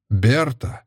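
ordinary delivery, nothing marked

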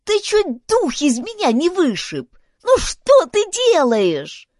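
high, drawn-out reproach; whining complaint. A narrator's reading. Girl scolds boy who fell on her, whining. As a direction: wail at him, squashed and aggrieved.

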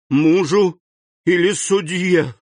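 лепет, выше и громче; пролепечи в страхе; faltering. A narrator's reading. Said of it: "пролепетала госпожа де Вильфор". (жена испуганно лепечет вопрос)

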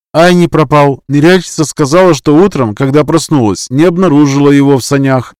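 urgent report from the tribe elder; worried elder woman. Acted.

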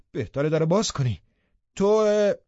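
heated reply breaking off into an oath; angry retort. A narrator's reading. Angry reply shouted from outside.

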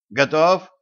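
high squeaky question. Acted. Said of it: "пискнуло".

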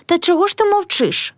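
narrated outcry, lifted high in pitch; crying out. A narrator's reading. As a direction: demand it in a mild, high cry. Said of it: "скрикнула ще голосніш".

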